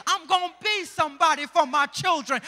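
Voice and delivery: strong preacher's vow; building sermon line; preaching declaration. Acted. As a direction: declare it to the church.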